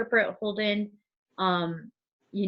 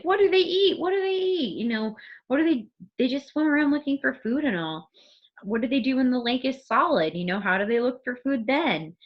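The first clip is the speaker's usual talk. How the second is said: freaking out; 6 semitones higher, 3 dB louder than usual. Retold or imitated. Holden's freaking out, like.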